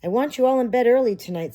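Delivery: loud, high, brisk mom order; bossy mother. Acted. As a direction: call the kids to bed firmly. Mother orders kids to bed.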